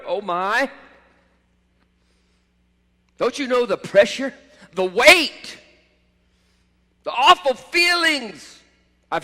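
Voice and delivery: rising, pleading lament of a wounded believer; anguished complaint. Retold or imitated. Preacher voicing a hurting believer's lament, building anguish.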